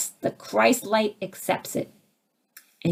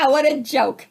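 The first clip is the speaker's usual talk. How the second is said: real, higher scornful laugh; scoffing laugh; 7 semitones higher than usual. Real, not acted. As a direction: laugh off the idea as absurd. Scoffs at changing others.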